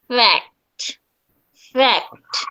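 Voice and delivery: ফ্যাক্ট said crisply, ending on a clear kt; fact demo. Shown as a demonstration. Teacher models ফ্যাক্ট for students as "fekt". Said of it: "আমি বলতেছি".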